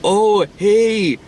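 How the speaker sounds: put-on high-pitched kid hello; imitated schoolboy greeting. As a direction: greet a crush in a high boyish voice. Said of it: "he's like".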